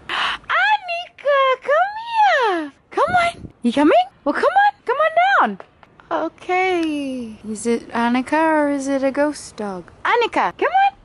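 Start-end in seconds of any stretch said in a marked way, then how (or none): 0.1-2.7 high, slow gasped call of the dog's name; excited call. Real, not acted. Spotting the dog, gasping. 2.9-5.6 very high sing-song dog call; high louder pet-talk calling to the dog. Real, not acted. 10.0-10.9 very high coaxing dog call; high louder pet-talk call. Real, not acted. Calling the dog again.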